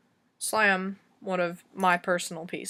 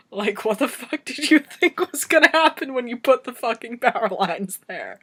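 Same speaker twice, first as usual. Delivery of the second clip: loud, raised-pitch incredulous telling; exasperated rant; 5 semitones higher, 8 dB louder than usual. Real, not acted. Telling how grandpa's willow met power lines, exasperated rant. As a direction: rant loudly in disbelief, punching the key words.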